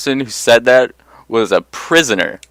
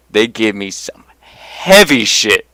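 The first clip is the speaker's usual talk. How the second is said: ordinary talk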